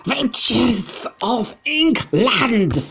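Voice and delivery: very high, loud, drawn-out witch call; witch yell. Acted. Grand High Witch calling out to her audience again, shrieking. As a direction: screech it across the hall. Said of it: "she yelled".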